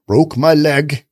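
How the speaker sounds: put-on slow, heavy Russian accent. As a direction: drag it out in a thick Russian accent. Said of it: "In a heavy accent, heavy Russian accent".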